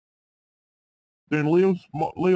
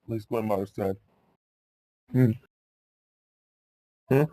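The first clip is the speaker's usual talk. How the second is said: plain reading voice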